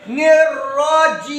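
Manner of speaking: निर्राजी with a long held final "ee"; three-alif elongation. Shown as a demonstration. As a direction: draw out the last vowel of निर्राजी very long. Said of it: "तीन अलिफ की मिकदार". (निर्राजी के आख़िरी स्वर को तीन अलिफ़ जितना खींचकर)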